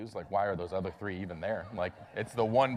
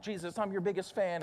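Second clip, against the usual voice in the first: mock gushing fan, pitched up; eager fan Peter; 8 semitones higher than usual. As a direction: play Peter as an overeager fanboy. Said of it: "who was all like".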